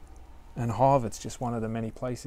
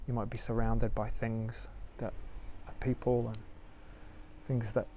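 guided meditation; 4 dB quieter than usual. Acted. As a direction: ease listeners into stillness gently.

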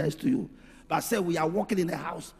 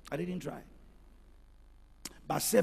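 normal talking voice

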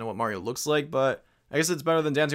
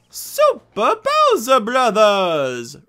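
announcer call; 8 semitones higher, 9 dB louder, much slower than usual. Pretend. Player announces retro game title.

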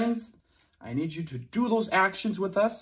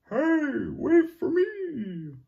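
high calling out; 11 semitones higher, 4 dB louder, slower than usual. Acted. Late dinosaur calls for bus.

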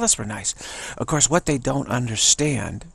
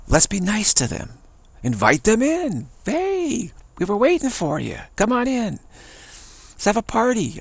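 much higher, gushing invitation; sugary hostess; 10 semitones higher than usual. Retold or imitated. Women inviting the reptilians in, high gushing welcome. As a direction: lay on the welcome, bright and eager.

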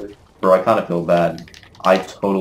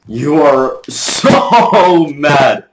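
loud, high, drawn-out jeer; slow, loud mocking shout; gloating taunt; 6 semitones higher, 8 dB louder, much slower than usual. Real, not acted. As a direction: stretch SO and crow it at the loser. Taunting a beaten opponent.

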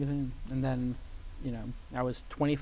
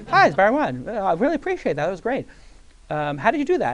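Barry calling, higher and louder, eager; excited caller; 5 semitones higher, 7 dB louder than usual. Retold or imitated. Barry Wine phoning the young IT guy, eager and high. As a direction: play the excited restaurateur on the phone.

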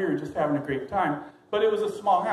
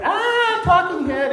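put-on high startled shriek of a girl; high, loud shrieking girl; girl's shriek; 12 semitones higher, 6 dB louder, slower than usual. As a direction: scream it like she's seen a monster. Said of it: "And she goes".

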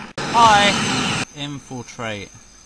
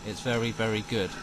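ordinary delivery

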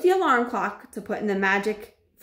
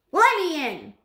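put-on higher, loud boy's call; high impatient boy; cried out; 9 semitones higher, 3 dB louder than usual. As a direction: call through the door like an impatient kid. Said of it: "cried the little boy's voice".

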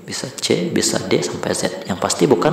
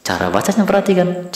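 ordinary talk, nothing marked

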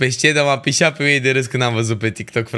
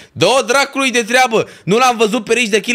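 much higher, louder exasperated cry; furious outburst; 9 semitones higher, 4 dB louder than usual. Real, not acted. Streamer raging to chat over [name]'s slot, furious. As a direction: vent the frustration at full voice. Rant about festival scheduling.

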